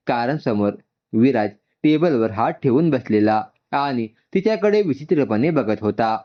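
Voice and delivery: ordinary delivery, nothing marked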